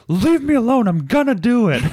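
high, loud, squeaky mock whine; shrill imitation of a nagged wife; mock annoyed wife. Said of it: "And you're like".

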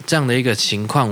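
ordinary talk

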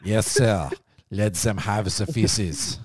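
French officer's slow order; mock French accent. Acts French general giving the order.

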